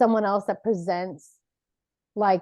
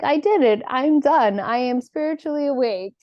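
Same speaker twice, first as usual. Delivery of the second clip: higher, louder smug proclamation; mock triumph; 5 semitones higher, 4 dB louder than usual. Mocking someone who thinks they're fully awakened, smug and triumphant. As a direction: ham up being done with awakening. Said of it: "and it's like".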